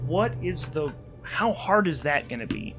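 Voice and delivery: ordinary talk, nothing marked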